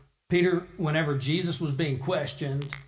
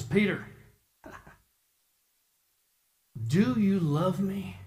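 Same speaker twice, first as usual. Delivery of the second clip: gentle. Retold or imitated. Jesus asks Peter a third time.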